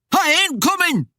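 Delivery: high, loud panicked refusal; bellow. A narrator's reading. Cornered man refuses Death through the window, bellowing. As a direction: bellow it back, pitch shooting up. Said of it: "Timmy bellowed".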